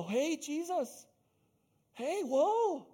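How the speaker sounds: high, slow, alarmed calling out; startled disciples. Retold or imitated. Disciples warning Jesus, high alarmed call. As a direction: pull him back, high and nervous. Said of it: "that's how I think they said it".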